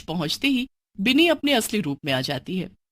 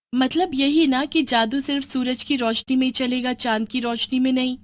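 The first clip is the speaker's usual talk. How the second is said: put-on young girl Bini; 5 semitones higher than usual.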